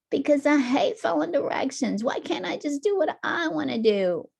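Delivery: high, whiny talk-back; defiant kid. Acted. Tommy talking back to his mom, defiant whine. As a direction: push back like a stubborn child.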